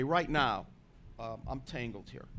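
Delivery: normal talking voice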